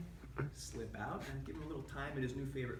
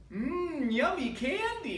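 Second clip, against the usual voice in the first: put-on higher, louder little kid savoring candy; toddler voice; 9 semitones higher, 7 dB louder, much slower than usual. Toddler to himself over the candy, hammed-up kid voice. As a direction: mmm it like a toddler who found sweets. Toddler eating Tums secretly.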